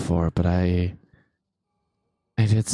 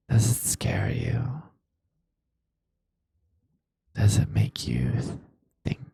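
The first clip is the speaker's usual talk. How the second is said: creepy ASMR whisper; 3 semitones higher than usual. Pretend. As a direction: whisper it like a taunting stalker. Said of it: "Never mention your voice again".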